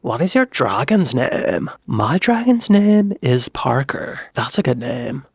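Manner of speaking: put-on slow, dragged-out valley girl drawl; valley girl accent. Valley girls in Game of Thrones chatting about dragons, valley girl accent. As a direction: draw every word out like a valley girl. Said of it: "see a valley girl show up in Game of Thrones".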